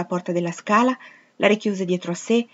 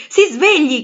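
higher and louder, desperate cry; desperate shout; 8 semitones higher, 9 dB louder, slower than usual. Acted. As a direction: yell it at full force to wake him.

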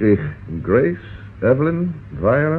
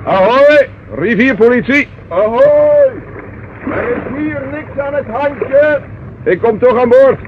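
loud, much higher hail across the water; calling out; 12 semitones higher, 3 dB louder, slower than usual. Acted. River police inspector hailing the moored barge, loud calling out. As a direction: shout it out to the boat midstream.

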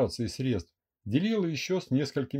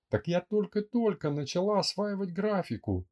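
frightened mutter; 7 semitones higher than usual. Acted. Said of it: "испуганно пробормотала девушка".

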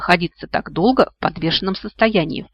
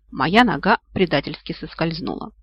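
usual speaking voice

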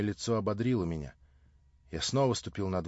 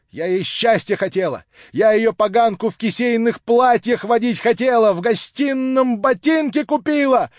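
higher, louder shrewish tirade to the neighbours; loud, higher, aggrieved harridan voice; ranting drunk landlady; 13 semitones higher, 12 dB louder than usual. Acted. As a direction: harangue the crowd like a spiteful drunk shrew.

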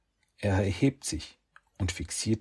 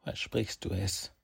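monotone; 9 dB quieter than usual. A narrator's reading. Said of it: "fragt Saki mit monotoner Stimme".

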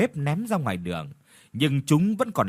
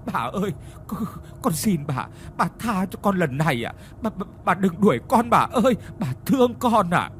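pleading; 5 semitones higher than usual. Acted. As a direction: beg harder and harder not to be thrown out. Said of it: "mà nài nỉ".

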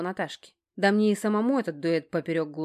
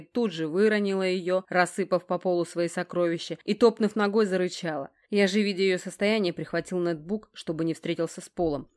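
usual speaking voice

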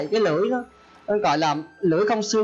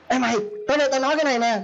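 higher, fast, brash calling a friend over; rude call-out; 6 semitones higher, faster than usual. Acted. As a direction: holler at a buddy carelessly. Gọi bạn kiểu mày tao. (giọng gọi bạn cao, nhanh, xấc xược)

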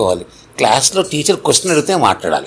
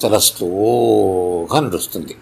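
dragged slowly; 3 semitones lower, much slower than usual. Shown as a demonstration. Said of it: "ఎంత slow అంటే".

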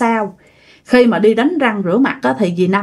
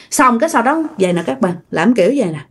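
plain reading voice

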